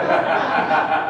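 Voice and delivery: breathy burst of laughter; laughing. Real, not acted. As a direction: laugh at the absurd choice. Laughing at his own choice.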